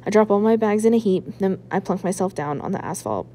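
usual speaking voice

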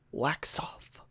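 breathy whisper of wax off. Retold or imitated. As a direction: whisper it like a calm sensei. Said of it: "an iconic scene from The Karate Kid".

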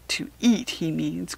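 put-on a touch of upset in a child's protest; close to tears. A narrator's reading. Harriet protests to her father, close to tears. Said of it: "cried Harriet, close to tears".

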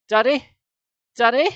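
loud, high calls rising at the end; piercing calls. A narrator's reading. Sarah calls for Dad in the tomb, piercingly. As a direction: call out to cut through silence. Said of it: "Sarah's voice cut through the heavy silence".

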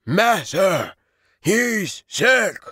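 high, loud wolf call; creature calling. A narrator's reading. False wolf calls to the sorcerer inside, high and loud. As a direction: call out urgently to the master.

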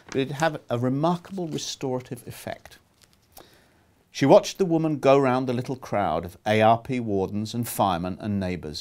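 ordinary delivery

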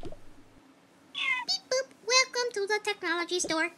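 high beeping robot greeter. Pretend. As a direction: chirp like a little robot.

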